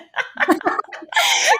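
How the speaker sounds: airy high-pitched laugh; breathy high laugh. Real, not acted. Host laughing at guest's leg-shaving joke, high airy laugh.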